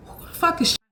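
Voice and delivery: whispered, high, tight demand at him; hissed demand. Retold or imitated. Waking the boyfriend to confront him, a hissed furious whisper.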